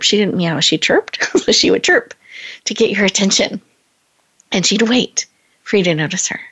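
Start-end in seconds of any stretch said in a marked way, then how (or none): 1.4-2.1 a bright little cat chirp on chirp; imitating a chirp. Host mimics her cat Nina, a high chirp.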